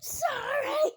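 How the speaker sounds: loud. Real, not acted. Child blurts out loudly.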